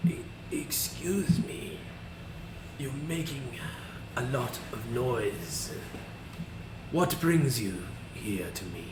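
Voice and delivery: put-on slow, halting old shaman voice; unhurried, quavering old man. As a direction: creak it out slowly like a frail elder. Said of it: "this really old man".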